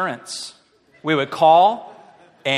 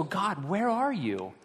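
slow, softer, a hint of despair; soft plea; 5 semitones higher, 6 dB quieter, slower than usual. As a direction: ask God quietly and hurt. Pleading prayer, general.

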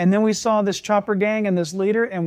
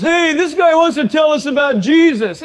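loud, higher jeering call to the gang; mocking biker call; 8 semitones higher, 9 dB louder than usual. Biker leader mocking the preacher to his gang, loud jeer. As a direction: announce it to the gang with a sneer. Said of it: "And the guy goes".

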